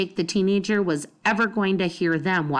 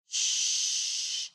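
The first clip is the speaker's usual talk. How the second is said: a soft breathy shh; whispered shush; 7 dB quieter than usual. Acted. Bean hushing baby Kalia at the window, whispered. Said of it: "she whispered".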